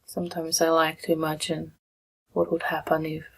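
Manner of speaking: soft meditation guidance with long pauses; guided meditation. Real, not acted. Meditation leader guiding listeners into breath and body, softly and unhurried. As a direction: ease them gently through it, leaving long silences.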